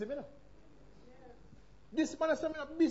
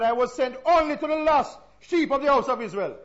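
louder, firm reply; firm refusal; 3 semitones higher, 9 dB louder than usual. Acted. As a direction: state it like a closed door. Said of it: "he answered".

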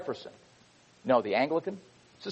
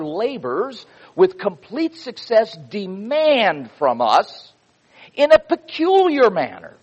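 fervent preacher's delivery; preaching a quote; 4 semitones higher, slower than usual. Acted. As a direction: proclaim it to the congregation like scripture. Preacher reads Washington quote with force.

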